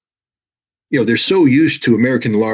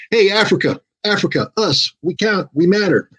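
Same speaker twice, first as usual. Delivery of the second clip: voiced much higher, pleading to be noticed; mock South Africa plea; 10 semitones higher than usual. South Africa telling the world it matters, in a much higher mock plea. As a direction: pitch it up high, like a country waving its hand for attention. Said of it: "South Africa is going".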